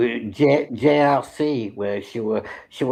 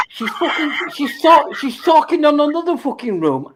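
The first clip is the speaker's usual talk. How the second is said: outraged; 10 semitones higher, 3 dB louder than usual. Real, not acted. Outraged retelling, pitch jumps.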